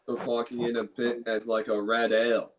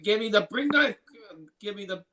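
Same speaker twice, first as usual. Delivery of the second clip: Nicolas Cage impression; 9 semitones higher than usual. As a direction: go big and unhinged on the knife line. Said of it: "That's my Nicolas Cage impersonation".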